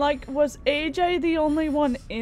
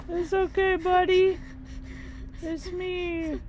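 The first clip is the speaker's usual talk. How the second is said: put-on soothing a child; 4 semitones higher than usual.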